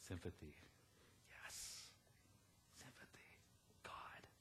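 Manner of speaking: barely voiced, hushed words to God; whispered prayer. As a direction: breathe it as a silent inner prayer. Inner prayer while she talks.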